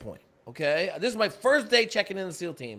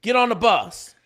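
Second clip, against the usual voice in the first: loud, raised command; brisk order; 5 semitones higher, 7 dB louder than usual. Retold or imitated. Briefer ordering jumpers onto the bus, loud. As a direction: send them off with a shout.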